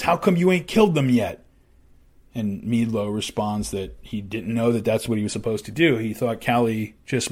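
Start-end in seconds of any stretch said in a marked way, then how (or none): 0.0-1.4 angry demand. Retold or imitated. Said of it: "he's angry".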